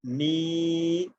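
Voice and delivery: می with the ee drawn out; long madd on mi. Shown as a demonstration. Teacher modelling madd for the class, held long. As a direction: hold the ee in می. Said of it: "میم یا زیر".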